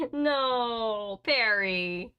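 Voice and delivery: real exasperated call to a misbehaving pet; scolding the pet. Real, not acted.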